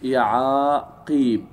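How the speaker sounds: يعاقيب drawn out slowly, long ي held; slow model plural. Shown as a demonstration. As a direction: give the class يعاقيب slowly. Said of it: "فنقول إيش؟". (نطق يعاقيب ببطء مع مدّ الياء)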